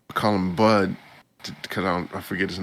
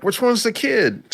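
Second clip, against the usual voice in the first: higher, offhand character voice; voicing Ray; 14 semitones higher than usual. Retelling Ray's line in the anime, in a higher character voice. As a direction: toss it off as the character. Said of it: "Ray's like".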